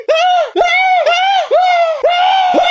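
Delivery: long, very high screams; horrified screaming. Acted. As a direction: scream at the top of the range. Screaming at the marks.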